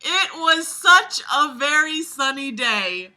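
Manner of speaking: slow, high, loud Southern Belle. Acted. Reader retrying the awful sentence, slow emphatic Southern Belle. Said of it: "do the Southern Belle voice".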